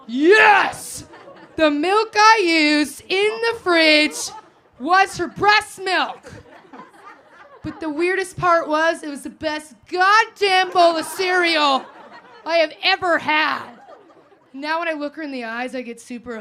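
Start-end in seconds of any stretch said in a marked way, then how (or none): 0.1-1.0 loud laughing yes; shouted yes. Real, not acted. Crowd confirms the reveal. 1.6-13.8 loud, slow, laughing delivery; slow it down and belt it; loud punchline. Real, not acted.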